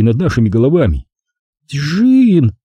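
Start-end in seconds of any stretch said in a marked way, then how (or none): none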